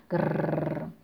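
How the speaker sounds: low growling grrr; giant growl. Acted. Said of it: "y gruñía". (gruñido grave de gigante)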